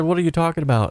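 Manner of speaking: what on earth are you saying; baffled disbelief. Retold or imitated. Friend baffled on phone call.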